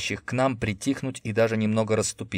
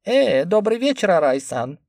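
high, innocent squeak; 9 semitones higher, 6 dB louder than usual. A narrator's reading. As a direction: sound suspiciously innocent. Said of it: "подозрительно невинен и тонок".

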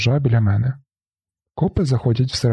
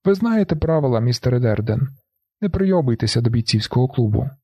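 plain reading voice

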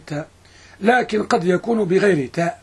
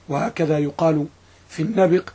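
ordinary delivery

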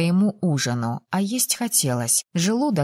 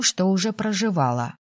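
ordinary delivery, nothing marked

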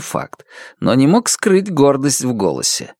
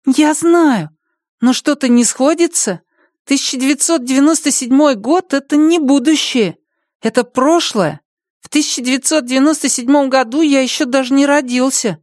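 put-on child voice; 13 semitones higher than usual. Boy argues.

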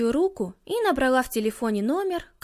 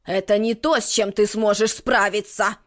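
stern witch warning; 4 semitones lower, 3 dB louder than usual. Acted. Witch warns.

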